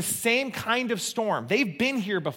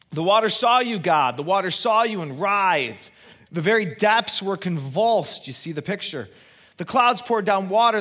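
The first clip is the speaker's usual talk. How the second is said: scripture reading; 2 semitones higher than usual. Acted. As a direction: read the psalm aloud with weight. Reads Psalm 77 aloud.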